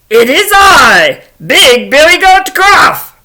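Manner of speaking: loud, slow, booming announcement; mighty goat bellowing back; big billy goat. Acted. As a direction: proclaim it high and loud, unafraid. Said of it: "along comes Big Billy Goat Gruff".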